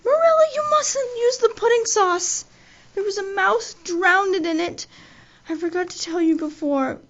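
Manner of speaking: high, loud panicked shriek across the dinner table. Acted. Anne shrieks to Marilla at dinner, high and loud. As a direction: blurt the warning in a high shriek. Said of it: "shrieked out".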